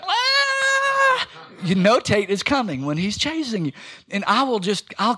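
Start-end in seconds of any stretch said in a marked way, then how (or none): 0.0-1.3 put-on high shouted toddler yell; toddler scream. Pastor imitating his toddler son screaming while chasing. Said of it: "he screams".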